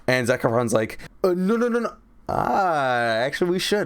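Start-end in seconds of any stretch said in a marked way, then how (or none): 1.2-3.9 higher mock panicked no; hammed-up quick refusal; Zac refusing. Zac Efron refusing Ricky, mock panicked and higher.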